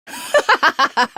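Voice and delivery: high villain chuckle; high smug villain chuckle; villain laugh. Acted. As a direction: chuckle at your own greatness. Villain gloats over praise.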